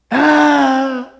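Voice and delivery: high, drawn-out mock sob; imitated child's wail. Child crying over a lost dog, mock wail. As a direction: wail like a bawling kid, hammed-up. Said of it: "un niño llorando".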